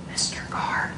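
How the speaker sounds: hushed, voiceless stage whisper. Retold or imitated. Flight attendant summoning a passenger by name, whisper. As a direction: whisper it discreetly into someone's ear.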